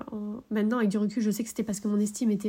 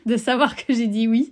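laughing through the words; half-laughing, still shaken; emotional laugh; 4 dB louder than usual. Real, not acted. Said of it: "ça me procure encore des émotions".

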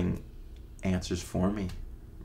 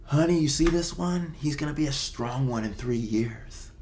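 put-on a light older-lady voice, pitched higher, calling to her husband; imitating the older lady; 7 semitones higher than usual. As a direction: lightly play the old lady pointing him out. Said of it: "she called over to her husband and was like".

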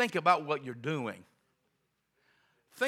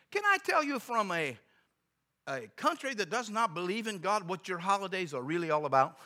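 put-on high, earnest foreign-accented offer; broken English; 6 semitones higher, 5 dB louder than usual. Visitor offering clerk the real holiday story, broken English accent. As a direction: imitate an Eastern European visitor's careful English. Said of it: "he spoke real broken English".

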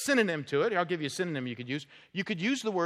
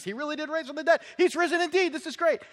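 high, fast, thrilled reporting; excited retelling; 10 semitones higher, faster than usual. Retold or imitated. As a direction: burst out with the good news. Disciples from Emmaus telling the news.

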